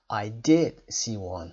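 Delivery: "did" loud and slow. Shown as a demonstration. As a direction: raise the voice and stress "did". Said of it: "صدامون رو هم میبریم بالا".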